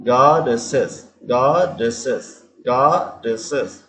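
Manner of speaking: गॉडेसेस said very slowly, each syllable drawn out; slow pronunciation demo. Shown as a demonstration. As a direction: spell out गॉडेसेस slowly for the class. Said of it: "इसमें डी double डी का sound आता है". (गॉडेसेस को धीरे धीरे बोलकर दिखाना)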